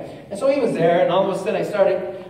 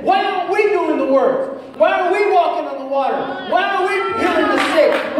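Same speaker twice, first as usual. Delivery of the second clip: higher, loud, rhythmic preaching; full-force preaching; 8 semitones higher, 3 dB louder than usual. Acted. Preacher challenging the church why not healing, preaching. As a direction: hammer each why at the crowd.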